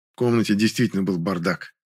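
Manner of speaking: ordinary delivery, nothing marked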